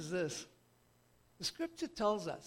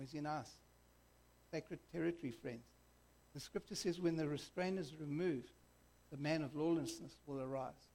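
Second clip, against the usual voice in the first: ordinary talk, nothing marked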